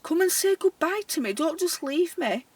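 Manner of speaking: high-pitched call to Arthur upstairs. Retold or imitated. Care worker calls to dead resident upstairs, mock-plaintive calling out. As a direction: call out playfully to someone who can't answer. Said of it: "So I called out".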